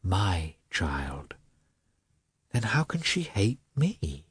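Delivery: a hushed private thought; husband despairing quietly to himself; inner thought. A narrator's reading.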